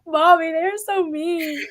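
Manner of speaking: high, drawn-out mock crying; whiny little kid. Little kids complain to mom about heel wrestlers, mock-tearful whine. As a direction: imitate a tearful child tattling to mom. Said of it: "the little kids are like".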